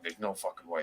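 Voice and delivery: mock low murmur of disbelief; low mock Animal. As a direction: drop into a hushed low voice for the big wrestler. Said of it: "And Animal's like".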